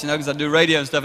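normal talking voice

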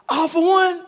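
high-pitched imitation of a team chant; retold team yell. Player rallying teammates before the game, retold yell. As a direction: call it out like a pregame huddle chant. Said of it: "we would yell out".

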